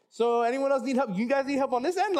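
high-pitched, flustered offer of help. Retold or imitated. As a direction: pitch it up with an embarrassed laugh. Flustered husband offering help elsewhere.

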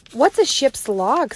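lightly voiced young Annie asking. Teacher reading to class, voicing curious Annie.

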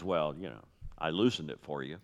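soft, low, understated aside; imitated wife. Wife to husband who opened the jar, soft imitated aside. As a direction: play the wife quietly claiming some credit. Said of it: "when your wife hands you a jar".

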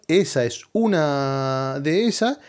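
usual speaking voice

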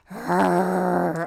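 low growling zombie noise. Retold or imitated. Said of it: "that's not a real zombie noise".

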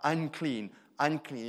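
loud, falling calls of unclean; crying out a warning. Retold or imitated. Leper warning passers-by to keep away, loud cry. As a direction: shout it so people keep away. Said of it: "He has to cry out".

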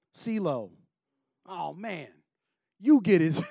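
casual street-game banter, barely acted; hint of soldiers shooting craps under the cross; lightly voiced dice-playing soldier. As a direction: toss off the lines like a guy at a dice game.

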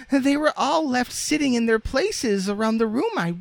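very high, piping falsetto; mistress's voice. A narrator's reading. Mistress puzzling over the moved dolls, high-pitched. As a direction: wonder aloud in a high little voice.

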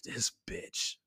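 whispered, exasperated aside; muttered aside. Retold or imitated. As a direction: say it under the breath, almost voiceless. His silent reaction to her reply.

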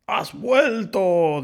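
high, loud, long drawn-out joy; delighted surprise. A narrator's reading. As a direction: stretch it out with a happy smile. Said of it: "dijo el lobo sonriendo". (alegría aguda y alargada)

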